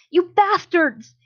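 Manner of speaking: loud, high, drawn-out outburst; outraged cry. A narrator's reading. Hizashi throwing a rock at the captor, outraged cry. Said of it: "Hizashi cried".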